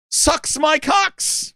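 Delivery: high, very drawn-out mock announcement; deadly earnest gamer. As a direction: stretch the rude tag out high and proud. Another fan announces rude gamertag.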